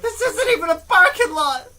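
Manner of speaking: high, loud imitation of an outburst; mock announcing. Listener mimicking his friend's classroom outburst, announcing it loudly. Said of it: "You just had to announce it".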